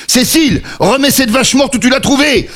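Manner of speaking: high, sharp scolding. Acted. Father orders his daughter to drop the dead cow, high scolding.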